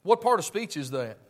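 loud, high-pitched pulpit preaching; fiery preaching. Acted. Preacher to his congregation, fiery full-force preaching.

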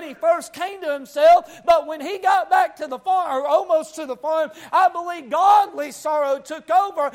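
urgent country-pulpit delivery; full-force preaching. Real, not acted. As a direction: preach it hard, line after line.

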